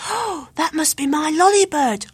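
hopeful cry of recognition; excited cry. A narrator's reading. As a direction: burst out with sudden hope. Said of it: "cried Luke".

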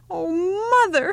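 put-on high, pleading child's cry; youngest child crying out. A narrator's reading. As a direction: whine up to mother like a little boy. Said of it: "he cried".

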